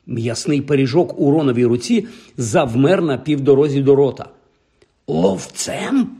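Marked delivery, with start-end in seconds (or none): 5.1-6.1 high, rising questioning echo; incredulous query. A narrator's reading. Ron asking Harry back about becoming Seeker, incredulous. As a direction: ask back in disbelief. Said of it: "перепитав він".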